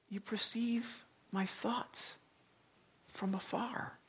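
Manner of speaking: hushed awe. Acted. Awed repeat of verse.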